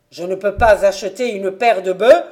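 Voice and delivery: loud, insistent challenge; choking anger. A narrator's reading. Invalid son insisting he can buy oxen, angry. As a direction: press the challenge at full voice. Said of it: "suffoquant de colère".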